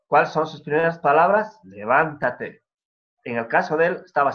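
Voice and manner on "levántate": "Levántate" drawn out slowly, each syllable weighed; slow emphatic command. Acted. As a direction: lay weight on "Levántate", unhurried. Preacher stresses God's command.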